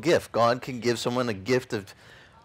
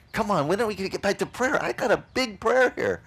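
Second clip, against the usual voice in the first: impatient; 7 semitones higher than usual. Retold or imitated. Impatient wanting prayer back.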